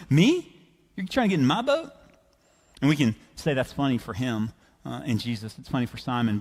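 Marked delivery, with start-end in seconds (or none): 0.1-1.9 incredulous. Acted. Simon asked to lend his boat, incredulous. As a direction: balk at being the one chosen.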